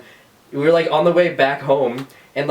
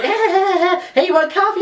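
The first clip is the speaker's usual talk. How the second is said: very high, sing-out offer of coffee; mock chirpy coworker. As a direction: imitate an over-eager crew member in a squeaky-high voice. Cheery crew mocked against grump.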